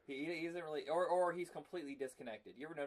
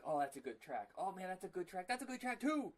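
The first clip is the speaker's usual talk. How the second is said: excited gushing; 5 semitones higher than usual. Retold or imitated. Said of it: "I'm like".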